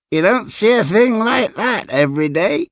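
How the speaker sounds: put-on very high, gloating old man; cracked triumphant voice. A narrator's reading. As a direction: show off the treasure in a high cracked voice. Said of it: "in his cracked, triumphant voice".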